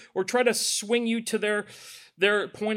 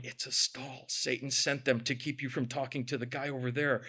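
Holy Spirit voice; 6 semitones lower, 10 dB quieter than usual. Retold or imitated. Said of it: "the Holy Spirit's going".

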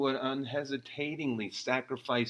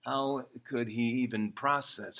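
ordinary delivery

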